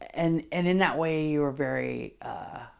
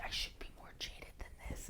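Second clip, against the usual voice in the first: whispered inner voice; 20 dB quieter than usual. Retold or imitated. Inner voice quoted in reading.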